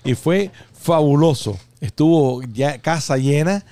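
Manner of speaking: ordinary talk, nothing marked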